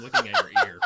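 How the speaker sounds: laughing. Real, not acted. Laughing embarrassed joke to host.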